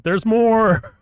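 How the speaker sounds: loud high shout; team yelling. Retold or imitated. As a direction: yell it out like the whole team. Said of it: "Team's yelling out".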